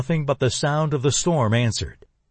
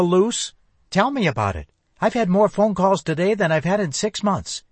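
phone operator; 5 semitones higher than usual. Acted. Operator voiced, chatting to officer.

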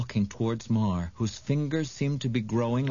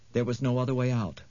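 plain reading voice

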